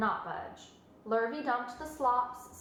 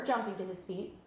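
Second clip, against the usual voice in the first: ordinary delivery, nothing marked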